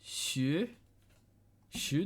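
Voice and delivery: normal talking voice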